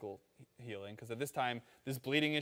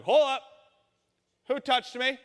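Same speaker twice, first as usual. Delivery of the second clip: higher and louder, an abrupt halt; Jesus freezing the crowd; Jesus stopping short; 11 semitones higher, 10 dB louder than usual. Retold or imitated. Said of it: "And he's like".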